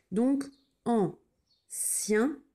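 Donc, ancien dragged out very slowly; very slow spelling voice. Shown as a demonstration. Teacher to pupils, very slow ancien. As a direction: drag ancien out for dictation. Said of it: "teacher slows the tricky word".

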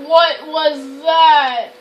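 very slow, stretched-out dismay; drawn-out fright. Real, not acted. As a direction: stretch every word in alarm. Startled, repeats it drawn out.

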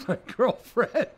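high, loud laughing word then giggle. Real, not acted. Guest laughing while looking over at his girlfriend. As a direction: laugh while glancing at her.

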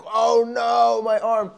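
loud, high cry of dismay; acted distressed wail. Skater laments his hurt arm before the shoot, loud mock wail. Acts out panic over injured arm.

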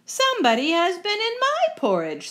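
high-pitched indignant mother bear; Mama Bear. Acted. Mama Bear finding her porridge disturbed, high indignant voice. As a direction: lift the pitch right up, put out. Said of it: "said Mama Bear".

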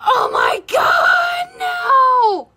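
high, loud anguished cry; screaming in dismay at a smudged eyeliner; real dismayed shout. Real, not acted. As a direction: wail it out in horror. Eyeliner slip on nose.

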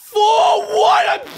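high, loud cry of protest; outraged yell. Real, not acted. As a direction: yell in frustrated disbelief. Outraged at missing the block.

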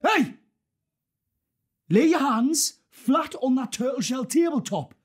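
high, forceful gunpoint order; shouted threat. Acted. Gunman ordering hands flat on the tabletop, shouted threat.

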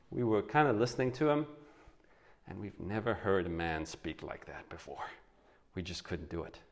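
sheepish confession. Retold or imitated. Guards explain to leaders why they failed, sheepish and soft. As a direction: mumble it like awed, embarrassed guards.

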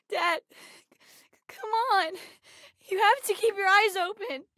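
high, tearful plea to dad; tearful pleading. Acted. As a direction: choke out a desperate plea through tears. Child pleading with unresponsive dad.

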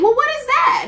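high, loud alarmed question. Retold or imitated. Hearing tumor from the doctor, loud alarmed question. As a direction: demand an answer in shock.

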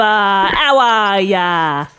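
put-on British accent. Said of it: "I just did my best British accent for you".

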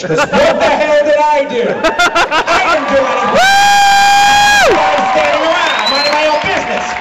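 loud, high indignant rant; hammed-up furious ranting; mock outrage rant. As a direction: work up a huge fake grievance. Ranting about blaming everyone.

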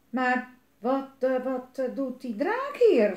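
halting stammer, rising in volume. A narrator's reading. Papa finds a dragon in his yard, stammering. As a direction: stumble over the words in alarm. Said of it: "stamelde papa".

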